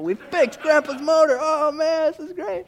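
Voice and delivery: put-on proud excited boy. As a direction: crow like a proud little kid. Boys proud of fixed motor.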